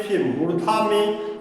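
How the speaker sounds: ordinary talk, nothing marked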